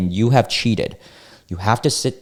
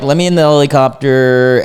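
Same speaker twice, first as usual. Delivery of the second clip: loud, raised-pitch imitation of pleading; mock demanding plea; 6 semitones higher, 7 dB louder than usual. Man asking to get in the helicopter, mock loud plea. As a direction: act out a man calling to be let in. Voicing man begging to board.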